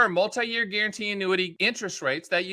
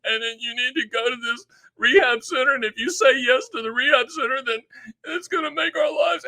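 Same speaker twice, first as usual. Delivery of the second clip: imitating a crying family reading an intervention letter; hammed-up weepy voice; mock tearful pleading; 4 semitones higher than usual. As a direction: plead through put-on sobs, climbing higher as it goes. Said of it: "they're crying and they're like".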